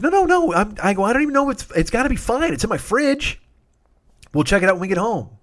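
high, frantic squeak of no no no, then settling; panicky, voice way up trying to sound calm; frantic reassurance. Retold or imitated. Panicked reassurance over pudding.

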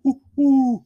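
high hooting owl call. Pretend. Podcast host hooting high at his co-host. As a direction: hoot it like an owl. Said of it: "We'll try to do it animal noises".

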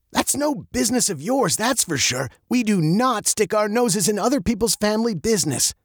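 high, firm scolding; stern father. Acted. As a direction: lay down the family rule.

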